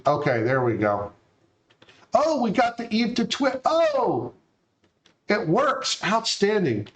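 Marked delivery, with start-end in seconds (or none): 2.1-6.9 excited. Real, not acted. Bot finally works on stream.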